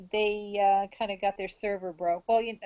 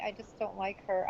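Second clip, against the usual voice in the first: quieter, a little sheepish; softer apologetic repeat; 8 dB quieter than usual. Real, not acted. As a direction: repeat it softly, half apologizing. Repeats it after "I'm sorry.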